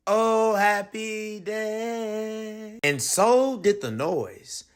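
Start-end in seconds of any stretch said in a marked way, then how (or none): 0.1-2.8 slow, dreamy relief; relieved. A narrator's reading. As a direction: savour the moment. Rain stops, relief.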